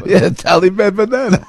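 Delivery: put-on sing-song "Taliban banana" in a joking Taliban accent. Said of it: "is that a Taliban accent?".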